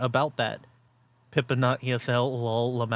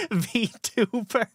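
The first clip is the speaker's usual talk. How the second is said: ordinary delivery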